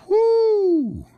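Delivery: long high whoop; excited whoop. Real, not acted. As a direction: let out a high, drawn-out "woo". Excited cheer over team.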